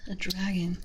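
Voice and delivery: ordinary delivery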